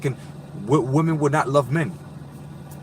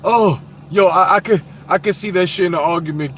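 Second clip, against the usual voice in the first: normal talking voice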